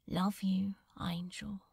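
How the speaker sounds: soft, casual whispered love, fading out; light whisper. Acted. Crowley facing the sea before the end, light whispered love. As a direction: toss it off lightly, barely voiced. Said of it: "his voice was light and casual".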